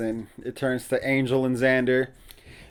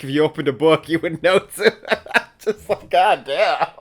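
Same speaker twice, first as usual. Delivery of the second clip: laughing; 3 semitones higher, 3 dB louder than usual. Real, not acted. Laughing at Giles's smugness.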